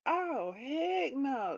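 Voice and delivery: high, drawn-out mock indignation; acted-out sassy refusal; indignant retort. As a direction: stretch it out in a high, offended refusal. Acted indignant retort in retold story.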